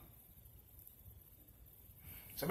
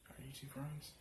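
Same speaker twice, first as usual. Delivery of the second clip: whisper; 8 dB quieter than usual. Retold or imitated. Quiet question among classmates.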